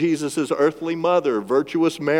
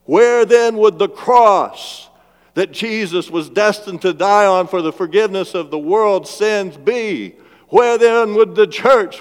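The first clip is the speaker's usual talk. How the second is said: preaching at full force; 7 semitones higher, 6 dB louder than usual. Acted. Preacher pressing the congregation with rising questions, full-force preaching. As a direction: drive each where-then question home from the pulpit.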